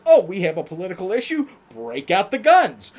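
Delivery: loud, hammed-up trigger-happy shrug; mock gung-ho. Mock voice of America's attitude.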